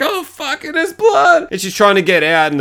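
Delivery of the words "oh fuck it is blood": very high mock-female exclamation; imitated alarmed woman. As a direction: mimic a woman finding blood, pitched way up. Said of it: "She's like".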